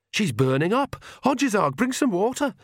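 high, urgent call for water; alarmed shout for help. Acted. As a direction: call out across the house in alarm. Calling for help across house.

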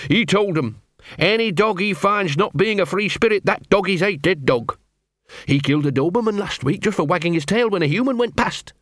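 high-pitched awed dog voice; fear and fascination. Acted. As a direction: tell it high, fearful yet fascinated. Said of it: "a mixture of fear and fascination".

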